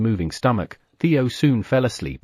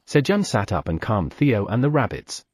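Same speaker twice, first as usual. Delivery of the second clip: usual speaking voice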